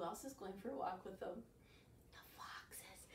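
secretive whisper. Acted. Reading to kids, secretive whisper. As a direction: breathe it like a secret. Suspense in the picture book.

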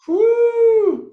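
high, loud gleeful whoop; excited whoop. Real, not acted. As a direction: let out a joyful whoop. Excited cheer in side bend.

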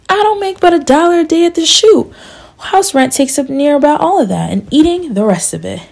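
a hint of a put-upon young man; lightly voiced young worker Love. A narrator's reading. Love answers Jeter.

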